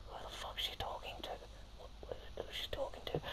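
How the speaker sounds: whispered, fast and confused; fast panicked whisper on a train; urgent whisper. Retold or imitated. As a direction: hiss it to your friend so she can't hear. Friends whispering about stranger.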